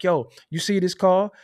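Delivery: ordinary delivery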